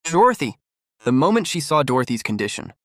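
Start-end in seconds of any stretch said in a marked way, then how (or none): 0.0-0.6 anxious shout across the room; worried call. A narrator's reading. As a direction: call her name out, worried. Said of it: "calling out worriedly".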